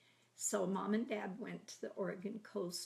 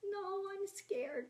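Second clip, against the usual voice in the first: scared refusal; 12 semitones higher, slower than usual. Retold or imitated. Shirley refuses to climb ladder.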